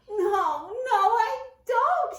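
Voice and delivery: high, loud, slow squeak; high tearful mouse squeak; unhappy squeak. A narrator's reading. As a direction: squeak it out in misery. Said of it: "he squeaked unhappily".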